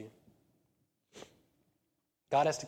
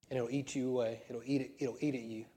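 normal talking voice

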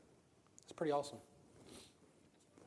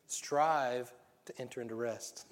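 "Strive" stressed, the rest drawn out very slowly; slow, weighty scripture line; slow emphatic quote; much slower than usual. Shown as a demonstration. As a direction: lay it down slowly, word by word. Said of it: "the Bible tells us to strive for is what".

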